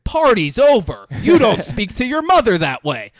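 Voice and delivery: very loud, high-pitched hammed-up dad yell; outraged dad shout. Dad steps in after daughter hits mom, hammed-up outraged shout. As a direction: bellow a parent's scolding, drawn out and over the top.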